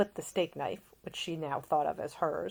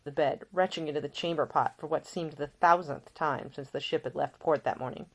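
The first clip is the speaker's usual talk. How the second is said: ordinary delivery, nothing marked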